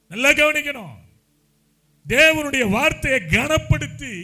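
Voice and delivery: high, forceful preaching shout; loud exhortation. Real, not acted. Preacher telling the congregation to listen closely, loud exhortation. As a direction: call them to attention at full voice, drawing it out.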